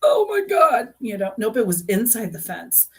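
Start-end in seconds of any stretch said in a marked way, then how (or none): 0.0-0.9 much higher, louder gasp of disbelief; shocked oh my God. Retold or imitated. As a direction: shriek it out in sudden shock. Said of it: "And I was like".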